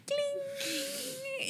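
tiny high-pitched cling noise; soft high sound effect; imitated cling. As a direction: squeak out a soft, drawn little noise. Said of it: "hicieron así".

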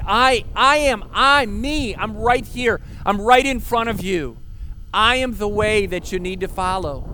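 high, loud, insistent I AM; booming emphatic claim of presence; emphatic Jesus voice. Acted. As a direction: declare it with full weight, pressing each I. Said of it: "It's, it's emphatic".

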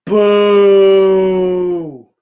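loud mock boo. Streamer boos Sublime to chat, loud mock boo. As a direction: jeer it out loud like a heckler.

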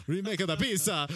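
hammed-up Italian accent, pitched high. Clerk repeats the pizza excuse in a mock Italian accent. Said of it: "just became Italian".

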